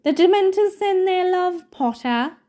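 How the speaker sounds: mock-cheerful taunt shouted over the crowd; Malfoy shouting. A narrator's reading. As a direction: call it out with a mocking sneer. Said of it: "who shouted through the crowd".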